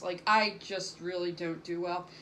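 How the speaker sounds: ordinary talk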